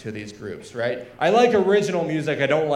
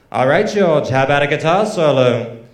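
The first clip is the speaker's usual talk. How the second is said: hammed-up Scouse accent, projected into the mic; mock Liverpool accent; 7 dB louder than usual. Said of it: "Liverpool accent".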